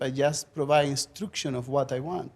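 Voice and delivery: ordinary talk, nothing marked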